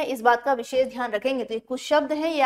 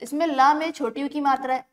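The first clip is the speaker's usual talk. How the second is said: usual speaking voice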